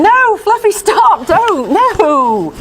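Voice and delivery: high-pitched mock protest; owner's high scolding. Owner pretending to stop her dog, high mock protest. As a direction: squeak out a feeble telling-off. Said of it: "she probably thinks she's saying".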